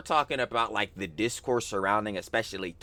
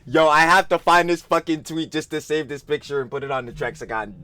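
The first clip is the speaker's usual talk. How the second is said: loud, high, breaking up with laughter; laughing hard; 5 semitones higher, 9 dB louder than usual. Real, not acted. Streamer cracking up at a pic, loud laughing. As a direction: barely get it out through laughs.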